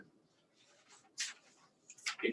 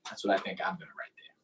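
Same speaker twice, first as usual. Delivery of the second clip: whispered aside; 7 semitones lower, 8 dB quieter than usual. Real, not acted. Aside to kids while modelling.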